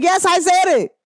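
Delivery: high, proud admission; unapologetic. Retold or imitated. To people mad at her, a defiant own-it. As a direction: own it with a bold upward voice. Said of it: "when I be like".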